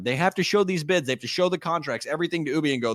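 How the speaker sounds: ordinary talk, nothing marked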